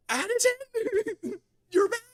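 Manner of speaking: very high, loud squeal breaking up; overjoyed shriek. Real, not acted. Streamer greets Addison's return, squealing with joy. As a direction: shriek a greeting, half laughing, half crying.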